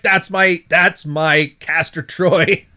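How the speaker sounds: slow, drawn-out swooning voice; mimicking a girl gushing about her man; mock smitten girl. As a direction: swoon over him like a starstruck fan.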